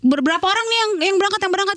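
high-pitched imitated huddle; rally the group, pitched well up; retold urgent questioning at normal volume; flustered planning.

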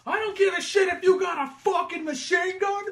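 hammed-up New York rage; mock furious shout. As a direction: roar it at the gunmen. Kaz confronting gunmen.

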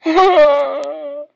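big real burst of laughter; loud high cackle. Real, not acted. Bursts out laughing at his loss.

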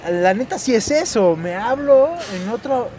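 más agudo y más fuerte, emocionado; suéltalo con euforia creciente; eufórico. Real, not acted. Excited self-praise while driving. (emocionado, se felicita a sí mismo)

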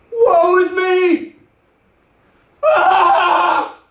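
slow high wail, then a loud cry; freaking out. Acted. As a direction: cry out in sudden terror before God. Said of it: "He starts freaking out".

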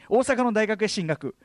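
normal talking voice

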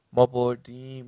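first cheer shouted at full volume; victory shout. A narrator's reading. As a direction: yell it out like the winning team.

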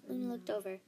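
usual speaking voice

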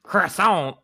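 put-on French accent, pitched slightly up. Shown as a demonstration. Soundboard guy showing his range to co-hosts, French accent. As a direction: say it like a cartoon Frenchman. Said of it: "he does a French accent too".

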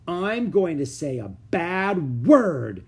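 loud, high, slow threat; shouting. Acted. Ramona threatens her family, shouting. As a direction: shout it, stamping your foot. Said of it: "she shouted with a stamp".

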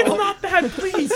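high, laughing, pleading; laughing plea. Retold or imitated. Mascot pleading with the fan, laughing. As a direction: beg through laughter. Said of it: "I'm like".

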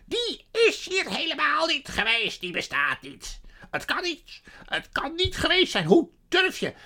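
high, loud outraged denial; furious sorceress. A narrator's reading. Witch denies he was ever here, furious. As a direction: screech in disbelieving fury. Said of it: "De tovenares sprong uit haar vel".

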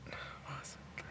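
whispering while trying to remember; breathy aside to himself; whispered to self. Real, not acted.

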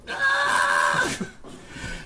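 very high, drawn-out scream; long high-pitched yell; acted scream. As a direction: scream like a captive being carried off. Halfling struggles in giant's grip.

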